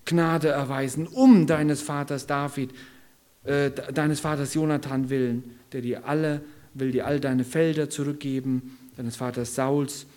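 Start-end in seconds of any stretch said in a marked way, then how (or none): none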